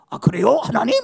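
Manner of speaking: sudden loud questioning burst; mock surprised reply. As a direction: play an astonished listener answering back. Pretend startled reply to God.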